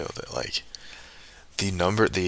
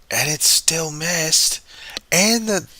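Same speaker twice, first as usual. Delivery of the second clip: higher, louder, slow exasperated cry; frustrated outburst; 10 semitones higher, 5 dB louder, slower than usual. Real, not acted. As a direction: let it burst out, exasperated.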